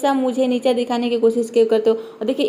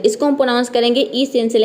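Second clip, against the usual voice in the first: usual speaking voice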